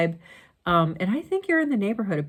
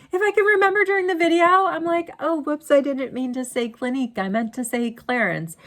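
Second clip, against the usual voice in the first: high, fast, louder "Oh, whoops"; bubbly self-correction; 7 semitones higher, 4 dB louder than usual. Retold or imitated. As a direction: mimic catching your own slip. Mock correcting herself mid-video.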